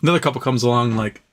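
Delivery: ordinary talk, nothing marked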